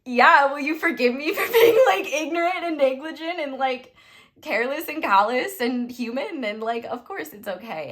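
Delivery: laughing through it, pitched high and loud; overwhelmed, half-laughing apology; laughing emotional reply. Retold or imitated. Tearful laughing reply to Jesus.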